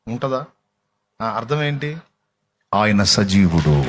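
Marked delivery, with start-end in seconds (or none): 2.7-3.8 low, slow declaration; solemn proclamation. Real, not acted. Preacher tells the congregation He is alive, solemn low proclamation. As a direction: state it deep and drawn out.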